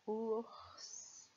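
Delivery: Ours stretched, with a long hissed final s; drawn-out word. Shown as a demonstration. Teacher shows the written word, stretched Ours. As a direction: sound out Ours slowly as ouuurss. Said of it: "Vous avez fait comme moi".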